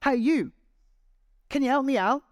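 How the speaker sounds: high, loud call for help; desperate shout upward; shouting up from the pit. Retold or imitated. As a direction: yell up from the bottom of a hole. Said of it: "the guy shouts up".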